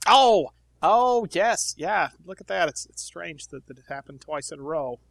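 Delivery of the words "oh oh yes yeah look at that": loud, high sarcastic oh of realization; sudden realization. Acted. Companion mockingly realizes the kid's news, loud and high. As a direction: act like it finally dawns on you.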